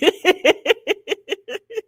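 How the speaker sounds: high, loud cackling laugh; long breathless laughing fit; helpless laughter. Real, not acted. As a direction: crack up completely. Cracking up at played clip.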